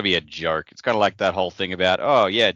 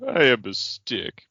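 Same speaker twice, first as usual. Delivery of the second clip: slow, slightly raised mock-sad voice; mildly mournful, drawn-out words; 4 semitones higher, slower than usual. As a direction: play a sorry little stick, drawing it out. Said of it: "Just hears a sad voice go".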